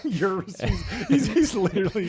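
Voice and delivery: high laughing words. Real, not acted. Laughing at the joke.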